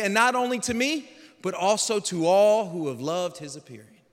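loud, solemn reading of Paul's words; scripture reading. Acted. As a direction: proclaim the passage like a sermon. Reads Paul's farewell passage.

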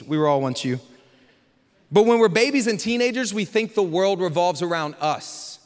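usual speaking voice

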